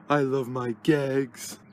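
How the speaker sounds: normal talking voice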